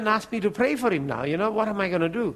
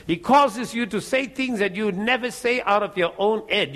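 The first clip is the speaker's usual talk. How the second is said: normal talking voice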